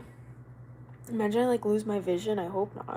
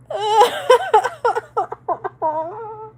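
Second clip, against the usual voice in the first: loud, high helpless laugh; high burst of laughter; 12 semitones higher, 7 dB louder than usual. Real, not acted. Streamer laughing at what the search said, loud and high. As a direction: crack up at the gross fact. Laughing at the eye drop fact.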